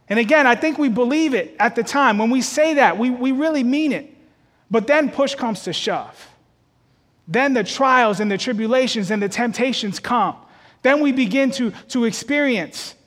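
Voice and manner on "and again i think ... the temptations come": ordinary talk, nothing marked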